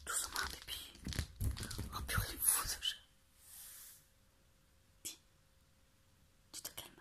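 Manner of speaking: soft whispering to a sleepy cat; ASMR whisper. Real, not acted. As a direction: hush it, barely a breath. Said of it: "Je fais de la A S M R".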